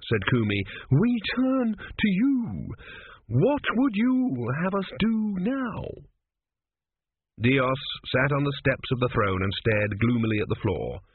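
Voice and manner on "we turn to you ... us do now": very high, slow, drawn-out intoning; priest's appeal. A narrator's reading. Kumi asks Dios what to do, solemn high appeal. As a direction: stretch each word in a high ceremonial voice.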